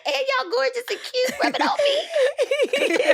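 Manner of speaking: excited squeal. Retold or imitated. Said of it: "I'm like".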